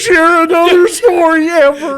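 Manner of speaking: very high comic squeal; mock outburst. Co-host playing offended over a story, mock squeal. Mock sulk after being teased.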